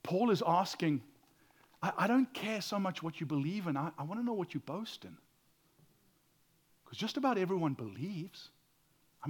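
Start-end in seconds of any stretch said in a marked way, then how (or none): none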